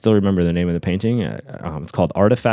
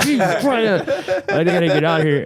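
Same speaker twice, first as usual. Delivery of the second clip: mock panic; 10 semitones higher than usual. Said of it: "and I'm like".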